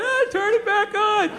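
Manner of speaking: high, drawn-out mild mock yell; hammed-up plea called up to the sky; mock screaming plea. Man outside calling up at a satellite, mock yelling. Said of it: "He's outside screaming up at a satellite".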